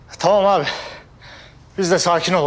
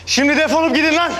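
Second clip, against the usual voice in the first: angry shouting; 13 semitones higher than usual. Acted. Chasing the thugs out of the place, shouting furiously. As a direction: bark at them to get out, full force.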